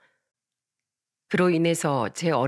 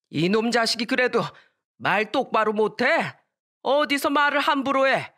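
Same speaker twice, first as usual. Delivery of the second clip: higher-pitched acted roar of reproof; angry grandfather; 5 semitones higher than usual. Grandfather scolds the girl for talking back, angry reprimand. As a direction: rebuke a rude grandchild sharply. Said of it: "할아버진 저한테 회초리를".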